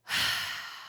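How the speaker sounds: long breathy demonstrative sigh; big sigh. Shown as a demonstration. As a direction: let out a big, weary sigh. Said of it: "That's a big sigh for me".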